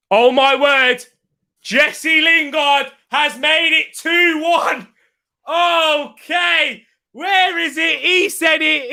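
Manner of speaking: high, drawn-out live commentator cry; excited goal commentary. Real, not acted. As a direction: call a late goal in real amazement.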